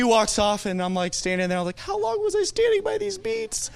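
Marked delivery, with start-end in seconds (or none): 1.8-3.7 very high, squeaky mock sob; mock weepy wondering. Man left standing after hugging a stranger, mock weepy. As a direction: wail the question in a comically high voice. Retold thought just after shared crying.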